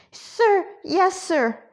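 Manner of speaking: high, eager yes-sir; jumpy little recruit's acknowledgement; flustered compliance. Acted. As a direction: answer up high like a startled cadet. Nervous reply to stern order.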